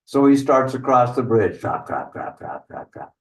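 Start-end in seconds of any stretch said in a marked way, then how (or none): 1.6-3.1 soft, low trap-trap fading away; hoofstep noises. Acted. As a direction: tap out hoofsteps, voiceless and soft. Imitating hooves crossing the bridge.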